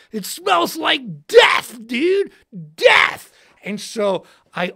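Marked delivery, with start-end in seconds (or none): 0.1-3.3 slow, loud yell of disgust; shouting that it stinks; shouted disgust. Retold or imitated. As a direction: bellow it out, appalled. Smelling dead possum, shouting.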